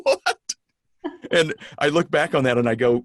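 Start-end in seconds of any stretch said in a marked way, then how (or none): 0.0-0.5 shocked what. Retold or imitated. Shocked reply to boss's warning.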